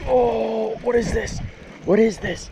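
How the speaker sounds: high, excited gasp at a bite; surprised. Real, not acted. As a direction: blurt it out, pitch way up. Another strike on the line.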